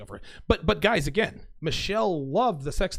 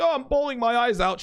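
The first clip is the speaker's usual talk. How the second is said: high, louder hammed-up fake crying; sarcastic fake tears to tease her; mock sobbing; 8 semitones higher, 3 dB louder than usual. Teasing the girlfriend who ended things, mock crying. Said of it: "I played with her a little bit".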